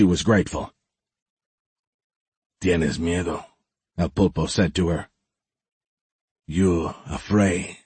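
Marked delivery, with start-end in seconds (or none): 2.6-3.5 menacing king. Acted. El Pulpo taunts Magnolia. 6.5-7.8 menacing king. Acted. El Pulpo taunts Magnolia.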